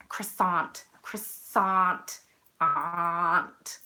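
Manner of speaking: "Croissant" as kris-AHNT, drawn-out "aunt" ending; mock "Croissant" with a hard American t; mocked American pronunciation. Shown as a demonstration. Mocking North American listeners, "Croissant" as kris-AHNT. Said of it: "if you're in North America".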